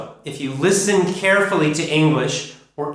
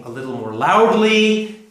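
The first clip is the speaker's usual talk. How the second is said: "loudly" raised and noticeably louder; stress on LOUDLY; 5 semitones higher, 4 dB louder than usual. Shown as a demonstration. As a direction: swell up on the word "loudly". Teacher says "loudly" louder.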